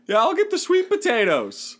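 high mocking voice for a tough guy ordering; mock dainty order; mocking falsetto. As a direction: pitch it way up to mock him.

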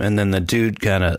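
usual speaking voice

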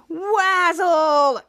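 high, stretched game shout; imitated cheer, held long; drawn-out call-out. Retells grandma calling out the word.

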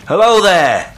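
loud, high hail; called out. A narrator's reading. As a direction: call out over the water. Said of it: "I called".

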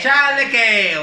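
"Chalequeo" drawn out slowly, like showing off the local word; "Chalequeo" said slowly, as a demo of the slang; Ecuadorian slang word. Shown as a demonstration. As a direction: stretch out "Chalequeo" slowly and savour it. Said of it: "Tienes que decirle la palabra chalequeo".

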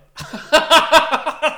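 high, loud burst of laughing; loud laughter. Real, not acted. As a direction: crack up at the analogy. Cracks up at the analogy.

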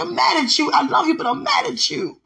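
high, loud hurt anger; mild retold anger. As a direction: tell God off through tears. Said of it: "And she's like".

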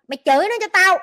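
loud, high, heated command; angry urging. Real, not acted. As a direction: egg them on to curse him out. Urges them to curse him out.

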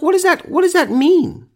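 a high confused squeak of disbelief; baffled. Retold or imitated. His reply to the women, high baffled disbelief.

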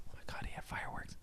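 very soft, rapid whisper of amazement; awed whisper. Acted. Comic recalling the pyrotechnics at the show, awed whisper. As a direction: marvel under your breath, barely audible.

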